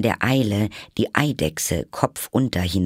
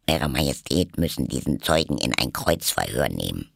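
quiet rabbit voice; 8 semitones lower than usual. A narrator's reading. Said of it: "welches leise sprach".

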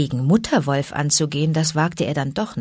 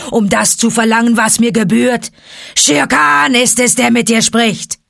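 a proud tiger demanding his prey; tiger's menacing growl; 2 semitones higher, 5 dB louder than usual. Acted. Tiger Shere Khan demanding the man-cub, loud snarl.

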